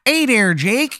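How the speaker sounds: high-pitched, hammed-up Chicago accent; cartoonish Chicago twang, pitched high; terrible Chicago accent. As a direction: greet him in an awful Chicago accent. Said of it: "worst Chicago accent you've ever heard".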